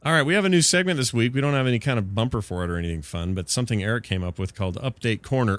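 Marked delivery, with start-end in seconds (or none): none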